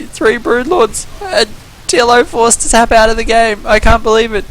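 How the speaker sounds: high, thrilled disbelief; can't believe what just happened; excited. Real, not acted. Caster reacts to the game ending.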